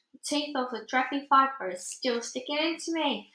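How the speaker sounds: plain reading voice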